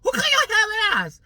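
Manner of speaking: high, loud put-on stylist voice; nosy hairdresser asks again, high and loud; mock stylist voice. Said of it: "She's like".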